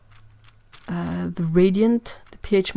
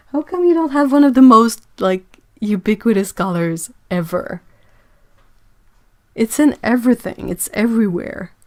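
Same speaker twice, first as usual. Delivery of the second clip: louder, higher, outraged disbelief; can't believe they lack it; exasperated disbelief; 3 semitones higher, 7 dB louder than usual. Real, not acted. Mock outrage at missing color.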